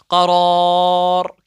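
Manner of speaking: करार with the second रा held very long; long stretched madd. Shown as a demonstration. Teacher shows the pause reading, holding the vowel long. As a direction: draw out the रा of करार before the final र. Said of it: "करार तीन अलिफ के बराबर क्यों खींचना है?".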